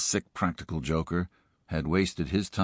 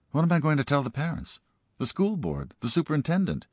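high, fast, flustered questions; flustered principal; 10 semitones higher than usual. Acted. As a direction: rattle them off in a rising panic. Principal panics in story.

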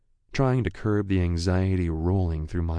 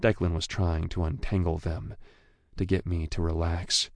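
ordinary delivery

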